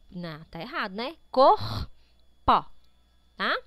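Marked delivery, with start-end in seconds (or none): 1.3-3.6 Corpo in two loud pieces, cor-po; syllable by syllable. Shown as a demonstration. Teacher corrects a word division, syllable by syllable. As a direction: punch out each syllable of Corpo, pausing between. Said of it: "todas as palavras estão separadas".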